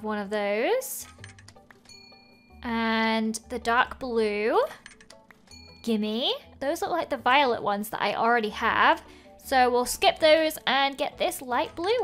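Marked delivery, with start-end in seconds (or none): none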